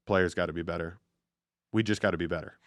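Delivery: low, quiet, emotionless monotone; Bill Belichick impression. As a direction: drop the voice and drone like a bored coach. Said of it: "Bill Belichick answers with".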